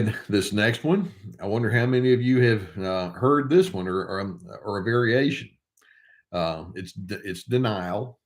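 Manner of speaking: ordinary talk, nothing marked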